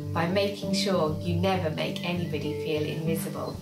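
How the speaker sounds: ordinary delivery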